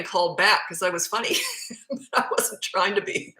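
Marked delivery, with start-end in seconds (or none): 1.4-2.1 real, high-pitched soft laugh. Real, not acted. Recalling an embarrassing live TV slip, amused laugh. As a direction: laugh softly at your own blunder.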